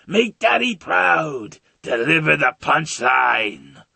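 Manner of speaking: loud, slow theatrical command; gleeful villain. Acted. Villain hands the boy a gun, gleefully commanding. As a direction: relish the order word by word. Joker ordering Joker Junior to shoot.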